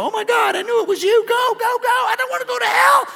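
a terrified cop begging to get away from hell; panicked cop. Retold or imitated. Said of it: "they go".

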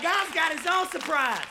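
loud, high, slow proclamation; preaching shout. Acted. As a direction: belt it out slowly as a triumphant answer. Preacher climaxes sermon point.

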